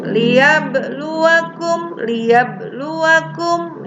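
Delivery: "Liyabluwakum" with a slight bounce on the b; small qalqalah. Shown as a demonstration. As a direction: chant "Liyabluwakum" slowly, echoing the b lightly. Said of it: "tapi kecil saja karena qalqalah sugro".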